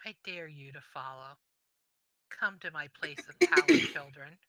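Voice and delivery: lightly voiced demon, measured with long pauses; understated demonic challenge. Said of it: "and goes".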